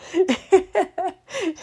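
high laugh bursting out; bright laugh. Real, not acted. Laughing at her own moving mess, high bright laugh. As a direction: laugh at the chaos of it all.

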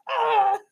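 high startled little gasp; shocked kid gasp. Retold or imitated. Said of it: "Looking at her like".

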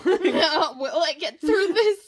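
laughing. Pretend. Mock self-pity, laughing.

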